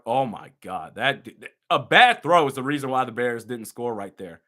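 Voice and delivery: ordinary talk, nothing marked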